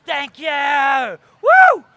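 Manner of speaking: full-volume high shout ending in a whoop; rock-star shout. Retold or imitated. CEO yells to the cheering crowd, hammed-up elation. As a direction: bellow thanks, then whoop.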